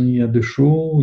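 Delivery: normal talking voice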